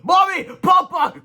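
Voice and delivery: very high, loud cry; high shrill-free shouted greeting; joyful shout. Acted. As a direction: call out to parents. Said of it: "cried Mister Crump".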